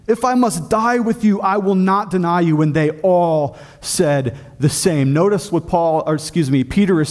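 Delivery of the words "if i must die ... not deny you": higher-pitched, insistent vow; emphatic. Retold or imitated. Peter vows loyalty to Jesus, emphatic. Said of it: "Peter said emphatically".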